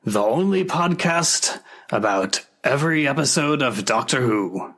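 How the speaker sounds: put-on Scottish accent for the podcast intro; hammed-up Scottish host welcoming listeners; a light Scottish accent that doesn't quite hold. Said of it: "I think my accent slipped a bit there".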